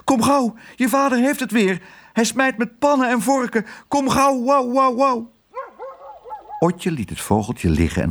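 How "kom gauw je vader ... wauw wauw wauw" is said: very high, agitated dog voice; nervously barking dog. A narrator's reading. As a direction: yap the urgent news like a dog. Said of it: "Hij blafte zenuwachtig en riep". (zenuwachtig blaffende hond)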